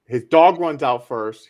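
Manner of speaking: usual speaking voice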